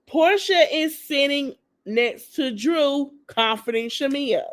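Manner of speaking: drawn-out, evenly spaced emphasis; deliberate repeat. Real, not acted. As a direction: repeat it slowly, word by word, to drive it home. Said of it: "I'ma say it again".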